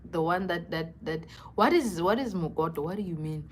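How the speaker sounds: usual speaking voice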